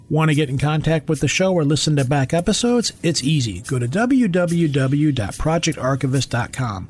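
loud radio ad voice; punchy podcast promo; announcer read. Acted. Recorded show promo read.

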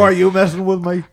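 high, loud, fast challenge; indignant Southern outburst. Acted. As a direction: demand it in a riled Louisiana accent. Exasperated at being misunderstood.

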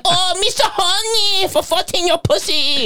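mocking Chinese voice quoting a creep; mock Chinese accent. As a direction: ham up a crude fake Chinese accent. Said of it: "does, like, Chinese voices".

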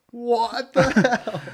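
high, loud, slow shocked shout. Retold or imitated. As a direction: yell it in disbelief, laughing. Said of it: "and they're like".

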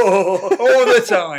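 laughing reply. Real, not acted. Laughing joke answer.

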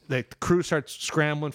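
ordinary delivery

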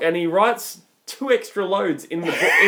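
usual speaking voice

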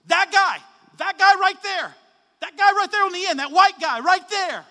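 loud retold call across a hall. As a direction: shout across the office, pointing him out. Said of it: "in a loud voice".

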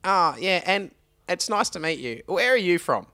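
high, put-on chummy colleague; high comic send-up of a greeting; comical Wayne voice. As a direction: mimic a comical colleague making small talk, pitched well up. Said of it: "Wayne's like".

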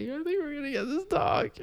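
high, soft, giddy squeak; mock giddy announcement. Telling parents about the dog, mock giddy. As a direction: break the news in a tiny high voice. Retold excited call to parents.

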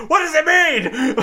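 high, laughing mock outrage. Mocking the girlfriend's outrage, high laughing voice.

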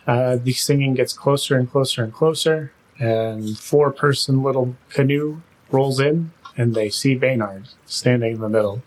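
ordinary talk, nothing marked

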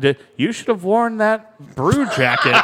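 put-on high, slow creaky old-timer; old man voice. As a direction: talk like a cranky old grandpa. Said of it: "you sound like Pawpaw when you're talking like that".

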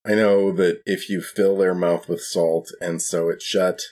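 ordinary talk

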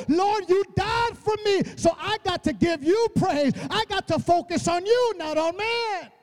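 high, driving sermon delivery; full-force preaching. Real, not acted. Preacher urging people to focus on God, impassioned preaching. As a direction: build into fervent, sustained praise aimed at God.

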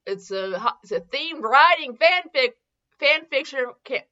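ordinary talk

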